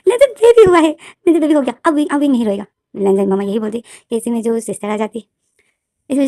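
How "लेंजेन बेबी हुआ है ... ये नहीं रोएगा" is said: much higher and louder, bursting with joy; overjoyed. Acted. Grandmother telling her son the baby is born, thrilled. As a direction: shout the good news to him. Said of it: "इतनी खुश हो जाती है".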